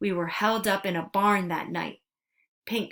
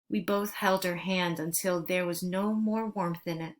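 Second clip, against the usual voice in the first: usual speaking voice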